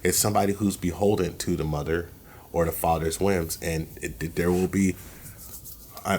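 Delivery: usual speaking voice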